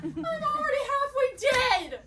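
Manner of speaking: despairing wail. Acted. Panicked rant about dying young.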